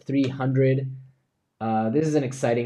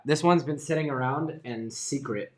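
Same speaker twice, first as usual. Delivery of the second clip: normal talking voice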